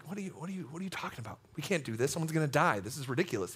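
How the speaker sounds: very soft, low protest under the breath; muttered disbelief. Retold or imitated. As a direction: mutter it privately, in imitated alarm. Retold silent protest to himself.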